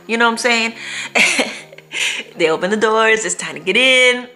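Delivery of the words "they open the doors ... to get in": usual speaking voice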